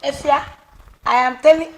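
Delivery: loud, high, furious shout. Acted. Mother yelling at her daughter about the pregnancy, a furious shout. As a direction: yell the news at her in outrage. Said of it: "Mommy, don't be shouting".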